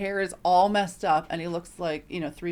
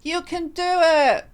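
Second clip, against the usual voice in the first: high, loud, slow cheer; mock cheerleader voice for the struggling fireman; cheery encouragement; 11 semitones higher, 10 dB louder than usual. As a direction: cheer him on, big and bright. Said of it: "I said".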